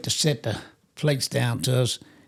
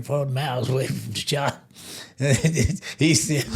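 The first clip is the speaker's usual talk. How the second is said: ordinary delivery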